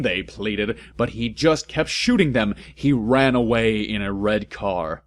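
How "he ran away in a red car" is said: usual speaking voice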